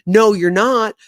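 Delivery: a mock playground argument yell; mock loud denial. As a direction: shout the denial like a kid. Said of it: "the other one's like".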